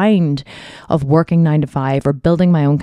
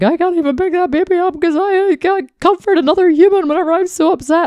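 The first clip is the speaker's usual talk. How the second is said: a much higher, strained tearful voice; distraught. Retold or imitated. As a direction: speak as if choking up while sobbing. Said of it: "And I was like".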